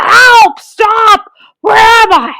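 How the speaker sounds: high, loud dazed cries; knocked silly and yelling; dazed crocodile crying out. A narrator's reading. Crocodile after the hippo's hit: high dazed cries. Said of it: "cried the crocodile".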